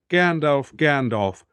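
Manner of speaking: plain reading voice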